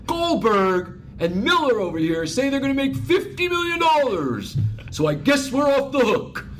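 high, loud laughing boss voice; Ballmer impression. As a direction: boom it out, half laughing. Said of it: "I do a good Ballmer".

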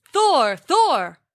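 loud call from afar; calling out loudly. A narrator's reading. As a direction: shout his name to catch them. Giant calling out to Thor.

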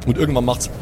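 ordinary delivery

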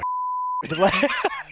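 high, helpless laughter; laughing. Real, not acted. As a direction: keep cracking up. Keeps laughing at the mix-up.

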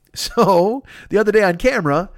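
normal talking voice

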